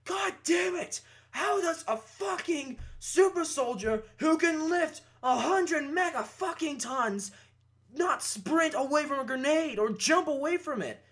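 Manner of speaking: high, exasperated mock rant; retold angry scream. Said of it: "I would scream at the top of my lungs".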